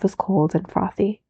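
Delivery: ordinary delivery, nothing marked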